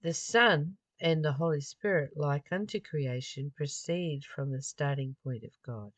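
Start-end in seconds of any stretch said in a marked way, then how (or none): none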